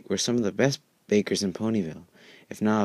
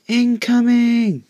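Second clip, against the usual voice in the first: very high, loud, drawn-out warning yell; filly yelling incoming; 9 dB louder, much slower than usual. Acted. Scootaloo on her scooter warns the ponies: long high yell. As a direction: shout it as you barrel in on a scooter.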